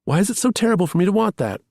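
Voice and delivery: pleading, close to a sob; tearful outburst. A narrator's reading. Lamb pleading with Charlie through tears, high and fast. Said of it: "She swallowed a sob".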